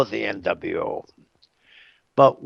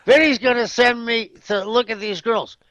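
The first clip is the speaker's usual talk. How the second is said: imitated eager brag, high and quick; mock excitement, pitched high and hurried; excited boasting; 7 semitones higher, faster than usual. Man boasting about being sent to see girls, excitedly.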